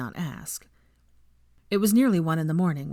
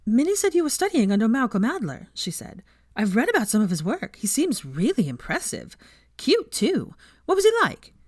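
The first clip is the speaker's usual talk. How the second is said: excited gush; 6 semitones higher, 4 dB louder than usual. A narrator's reading.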